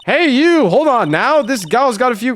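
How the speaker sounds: high, loud Southern gal calling out; Southern accent. Acted. Brash photographer butting in on strangers, high Southern accent. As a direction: holler it with a Southern twang. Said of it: "heard that southern accent somewhere before".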